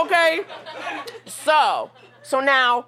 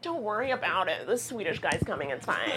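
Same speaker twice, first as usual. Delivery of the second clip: much softer, dropping lower by the end; dismissive hush; 3 semitones lower, 9 dB quieter than usual. Retold or imitated. Herself brushing off the African stranger, soft and dismissive. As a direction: wave it off under your breath.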